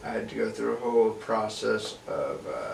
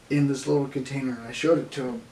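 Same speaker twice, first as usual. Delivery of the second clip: ordinary talk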